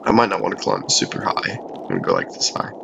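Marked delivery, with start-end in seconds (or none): 1.6-2.7 whispered aside, much softer. Real, not acted. Gamer whispering a sneaky plan to viewers, whispered. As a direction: drop to a breathy whisper mid-game. Hushed aside while playing.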